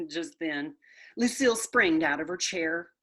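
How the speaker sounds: ordinary delivery, nothing marked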